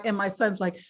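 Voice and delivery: ordinary talk, nothing marked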